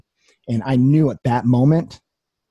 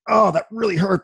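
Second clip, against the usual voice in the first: pained exclaim; 9 semitones higher than usual. Retold or imitated. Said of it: "I was like".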